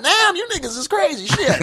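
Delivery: shrieked-up famous comedian; Richard Pryor impression. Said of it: "And Richard Pryor got".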